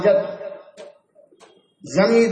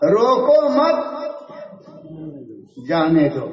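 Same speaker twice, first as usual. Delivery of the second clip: raised "روکو مت", settling on "جانے دو"; pause after مت; 4 semitones lower than usual. Acted. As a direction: read "روکو مت" as one command, then "جانے دو". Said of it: "اس نے پڑھا".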